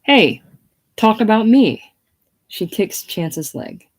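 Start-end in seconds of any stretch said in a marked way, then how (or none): 0.0-1.8 loud, higher angry insistence; hissed demand. Acted. Chance behind princess on the phone, hissed demand. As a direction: hiss it forcefully, demanding attention. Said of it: "Chance hissing behind her".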